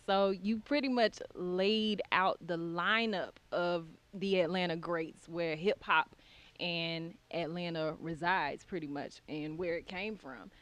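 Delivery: ordinary talk, nothing marked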